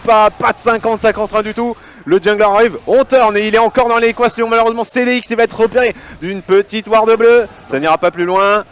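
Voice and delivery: fast hyped live commentary; esports caster. Acted.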